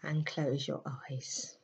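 soft, unhurried meditation voice with long pauses; guided meditation. Acted. Leading listeners into a May meditation, soft and soothing.

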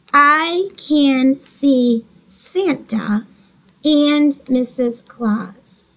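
very slow, word by word; modelling careful reading; slow reading aloud. Shown as a demonstration. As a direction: read it out slowly for the class. Teacher reads final sentence.

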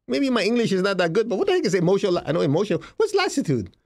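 ordinary delivery

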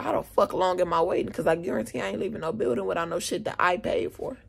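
ordinary talk

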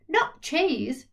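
indignant Not cheese; indignant cry. Acted. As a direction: Mum recoils at the idea. Mum objecting in story.

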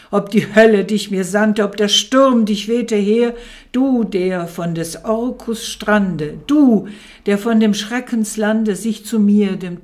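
loud, fast, desperate plea; crying out in doubt. A narrator's reading. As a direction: hurl the questions at the bird. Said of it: "rief ich voll Zweifel". (lauter, verzweifelter Ruf)

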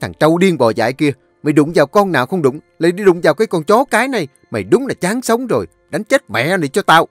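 high, loud, fast yelling curses; husband beating the other man, screaming curses; cursing rage. A narrator's reading. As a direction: scream abuse while beating him. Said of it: "miệng vẫn không ngừng rủa xả".

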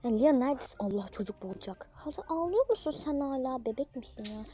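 high playful role voices; acted mom and daughter skit. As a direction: play mom and whiny daughter in turn. (anne kız rol oyunu)

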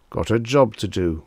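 ordinary delivery

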